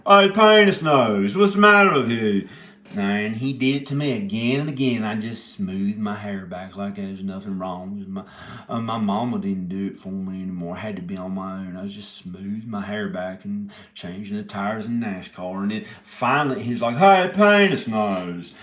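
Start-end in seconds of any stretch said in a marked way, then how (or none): 0.1-2.5 loud, high jeering bully call; mocking taunt. Bully taunting him in story. 17.0-18.5 loud, high slow jeering call; mocking taunt. Bully taunts again.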